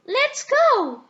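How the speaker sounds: high, loud happy cheer; a little girl shouting with joy. A narrator's reading. Peppa cheers as the family sets off, high and loud. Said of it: "cheered Peppa".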